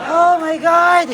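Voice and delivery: slow amazed exclamation. Real, not acted. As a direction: drag it out in awe.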